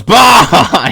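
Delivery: mock outburst. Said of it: "He just goes".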